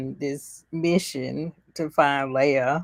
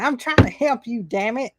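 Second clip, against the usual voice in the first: higher, mock-frustrated protest; exasperated pleading; 6 semitones higher, 4 dB louder than usual. Obi-Wan chasing the fleeing girl, exasperated imitation. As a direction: imitate a fed-up rescuer, pitched up.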